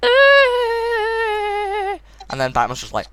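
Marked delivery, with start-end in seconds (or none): 0.0-2.0 long high-pitched squeal; mock traumatized whine. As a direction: hold a thin falsetto "eh", hammed up. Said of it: "and she's like".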